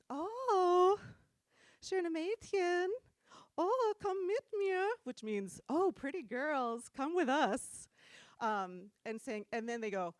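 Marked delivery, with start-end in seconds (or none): 0.1-4.9 high, slow, leering croon; imitating skeezy dudes. 5.7-7.9 slow, mock-seductive coaxing; imitating skeezy dudes.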